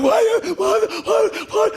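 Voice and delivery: put-on high, loud whining child voice; whiny little kid. Little boy whining at the bully who took his lunch. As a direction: plead like a scared little boy.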